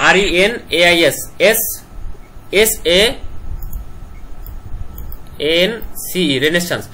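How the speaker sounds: R E N A I S S A N C, one letter at a time; sounding out words. Shown as a demonstration. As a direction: spell renaissance letter by letter, pausing. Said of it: "এভাবে ভেঙে ভেঙে পড়বা".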